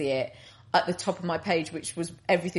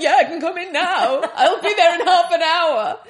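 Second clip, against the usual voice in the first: higher, louder, laughing eager reply to a shop assistant; eager yes; 10 semitones higher, 9 dB louder than usual. Retold or imitated. She tells the podcast host how keen she was, laughing and eager. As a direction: jump at the offer with a laugh. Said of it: "And I'm like".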